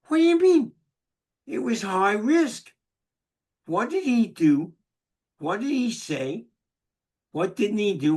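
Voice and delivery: high, loud disbelieving questions; incredulous questioning. Retold or imitated. Husband pressing his wife in loud, high disbelief. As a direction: press her in disbelief, voice jumping up.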